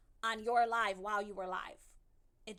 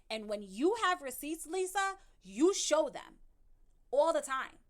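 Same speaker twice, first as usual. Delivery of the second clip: louder, higher, calling her out; raised voice scolding a streamer; heated call-out; 4 semitones higher, 5 dB louder than usual. Real, not acted. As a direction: press the point hard and loud. Calling out Lisa's hypocrisy.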